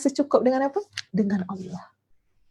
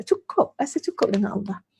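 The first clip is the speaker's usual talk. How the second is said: normal talking voice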